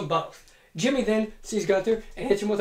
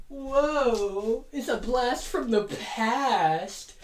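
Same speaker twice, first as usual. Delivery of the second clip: usual speaking voice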